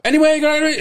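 high, brisk handoff; imitating aunt. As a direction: toss it off quickly. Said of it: "goes back to my dad".

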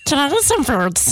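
a hint of Gollum ordering food. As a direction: ask in a creepy creature voice. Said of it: "it's even my Gollum voice".

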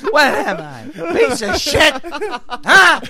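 high, loud, drawn-out angry yell; hammed-up comic meltdown at the audience; onstage breakdown. Old comic berating a diner mid-act, enraged. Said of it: "he'd have the breakdown on stage".

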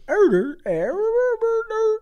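lightly voiced creature grunts; Grimace gibberish. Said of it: "this is translation".

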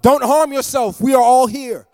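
loud, high urgent call; shouted plea across the prison; Paul shouting. Retold or imitated. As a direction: cry out to stop him. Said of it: "But Paul shouted".

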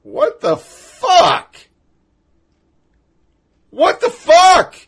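high, slow, stretched disbelief; mock outraged disbelief; incredulous outburst. Real, not acted. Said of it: "And that's when I go".

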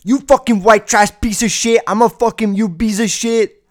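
loud, high-pitched imitation of a raging caller; mock mad rant. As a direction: yell it as a hammed-up furious tirade. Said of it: "you get mad to the point where you're like".

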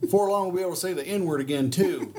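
mock the smug follower; mocking Trump supporter. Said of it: "his blind followers ... is like".

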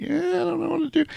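very high, quick, soft mock whine; hesitant squeamish girl. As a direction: squeak it out as a helpless imitation. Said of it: "poking him on the chest and".

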